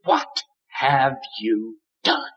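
slow, soft, pained question; grieved conviction. Shown as a demonstration. Preacher voicing God's inner conviction, slow and hushed. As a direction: let the pain sit in each word, slowly. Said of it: "let me tell you how it sounds".

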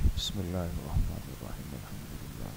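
soft, low prayer murmur under the breath; murmured recitation. Acted. Teacher leading students in quiet recitation, murmured softly. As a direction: recite it quietly to yourself, inward.

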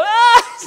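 high, loud strained scream. Retold or imitated. Alone under the bench press, screaming loud. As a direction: yell so the next building hears. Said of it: "People in the other building could hear me go".

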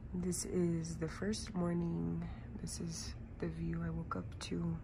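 soft whisper, barely awake; whispering so nobody wakes; sleepy morning whisper. Real, not acted. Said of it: "can't even get my morning voice going".

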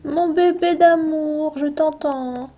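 high, slow, loving coo. Real, not acted. Cooing at cute villager.

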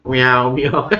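light imitated meowing, playful; teacher mimics her cat's meows to the class. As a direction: meow like a cat asking for food. Teacher imitates cat at door.

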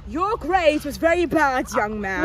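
loud, slow mock British scolding; British accent. Professor scolding a student about grades, British accent. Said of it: "Why is it always a British accent?".

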